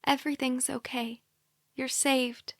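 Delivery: soft, hushed reassurance; calm her like a mother would; a captor soothing a waking girl, softly; soothing whisper. A narrator's reading. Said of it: "the soothing voice whispers".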